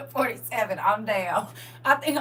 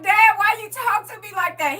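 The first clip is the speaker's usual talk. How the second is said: mock crying; 8 semitones higher, 4 dB louder than usual. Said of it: "I would just be crying like".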